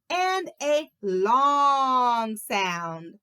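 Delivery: very slow, elongated "long"; drawn-out LONG. Shown as a demonstration. Teacher explains vowel sounds to children, drawn-out "long". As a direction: stretch "long" out slowly to match its meaning.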